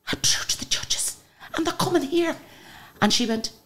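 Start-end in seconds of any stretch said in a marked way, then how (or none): 0.1-2.4 stunned breathless whisper. Retold or imitated. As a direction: whisper it in disbelief to your daughter. Telling daughter in shock.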